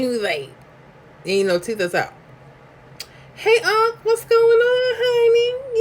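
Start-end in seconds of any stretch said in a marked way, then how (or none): 3.3-5.8 high, falsetto sing-song hello; cheery mock-sweet falsetto greeting; sweet greeting to uncle. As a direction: greet him brightly in a squeaky high voice. Greeting toothless uncle, retold.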